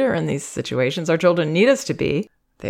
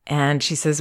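ordinary delivery

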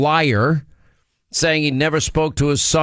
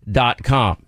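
normal talking voice

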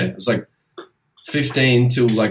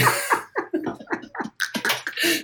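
airy wheezing laugh up high; high breathless laugh; 7 dB quieter than usual. Real, not acted. Cohost cracking up describing trolley workers, breathless laugh.

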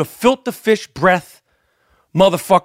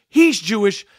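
normal talking voice

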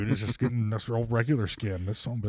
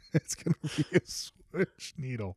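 slow, laughing delivery; 6 semitones higher, 6 dB quieter, slower than usual. Shown as a demonstration. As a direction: giggle through it.

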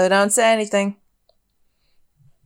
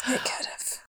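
whispered; 13 dB quieter than usual. Real, not acted. Wistful whispered agreement.

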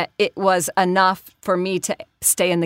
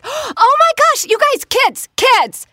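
very high, loud mock squeal calling the kids over; hammed-up grown-up summoning kids to meet her; excited wedding guest; 11 semitones higher, 5 dB louder, slower than usual. Guest at a wedding calls kids over, loud high mock squeal. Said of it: "thinks it's really fun to say".

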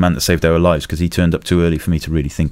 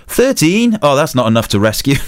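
joking lift operator; 7 semitones higher than usual. Retold or imitated. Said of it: "And the bloke goes".

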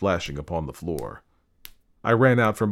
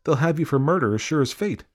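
alarmed burglar; 8 semitones higher, 4 dB louder than usual. Acted. Burglar warns the killer.

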